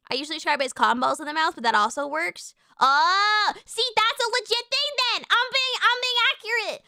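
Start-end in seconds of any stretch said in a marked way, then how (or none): 2.8-6.8 higher, excited self-defence; vindicated. Real, not acted. Streamer defending her accent descriptions to chat, vindicated. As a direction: exclaim it high, as if proven right.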